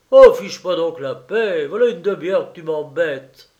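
loud, exasperated outburst; fed up. A narrator's reading. Husband telling his pestering wife off, loudly fed up. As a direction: brush her off loudly and crossly.

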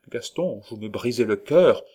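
ordinary delivery

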